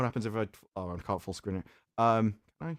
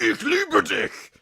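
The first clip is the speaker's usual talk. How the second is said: slow, higher mock German, a light parody; harsh German; 12 semitones higher, 3 dB louder, much slower than usual. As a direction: drag out the German like a threat. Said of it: "I love you" is like".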